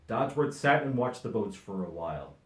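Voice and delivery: ordinary delivery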